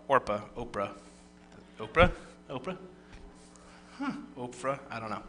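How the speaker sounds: real puzzled aside, very soft; unsure muttering. Real, not acted. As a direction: try the name out under your breath. Unsure how the place name is said.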